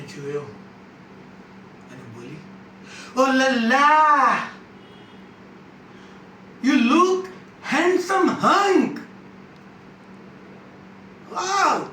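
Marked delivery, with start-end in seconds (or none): 3.2-11.9 high, drawn-out flirty exclamation; over-the-top swooning compliment, pitched way up; gushing admiration. Acted. Woman's admiring exclamation, acted.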